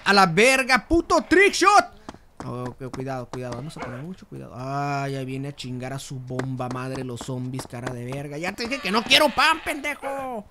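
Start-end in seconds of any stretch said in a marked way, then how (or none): 0.0-2.0 loud, high shout, last words stretched; grito de triunfo. Real, not acted. Celebrates a trickshot. 8.4-10.4 regaño fingido. Pretend. Mock yelling at zombies.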